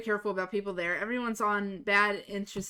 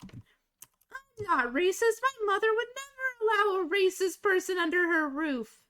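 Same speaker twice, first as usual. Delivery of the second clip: sing-song imitation of a bratty girl; mocking bratty kid; 11 semitones higher than usual. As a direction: mimic a smug twelve-year-old. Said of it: "I'm about to share her famous line".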